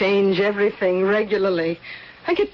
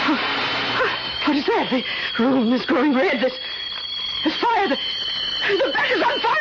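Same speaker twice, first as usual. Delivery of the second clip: terrified scream. Acted. Woman in the burning bed screams as the flames take her. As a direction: panic climbing into screaming.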